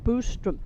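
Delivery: Boström said Boo-strum, rounded oo; Boström with the Swedish oo vowel; Swedish pronunciation. Shown as a demonstration. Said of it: "Not Ba".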